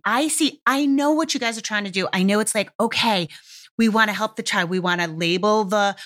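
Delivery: ordinary delivery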